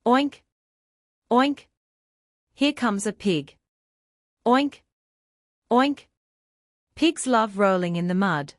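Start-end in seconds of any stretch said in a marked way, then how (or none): 0.1-1.7 high, loud playful oinks; pig oink. Pretend. Pig sound for children. 4.5-6.1 high, loud playful oinks; pig oink. Pretend. Pig sound for children.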